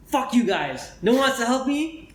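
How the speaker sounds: angry yell. Retold or imitated. Retold beggar throwing a fit.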